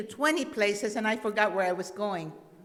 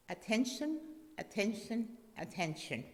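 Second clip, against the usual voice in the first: calm fading master; 7 dB quieter than usual. Acted. Master writes it a third time.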